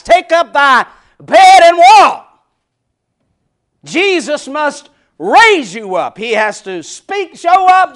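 loud, slow, ringing proclamation; loud preaching. Acted. Preacher proclaiming Jesus' command, loud. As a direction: preach it out big.

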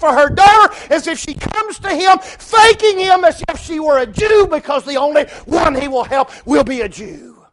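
loud, high-pitched sermon delivery; full-force preaching. Acted. Preacher telling the congregation of the woman, full-force preaching. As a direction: preach it out at a shout.

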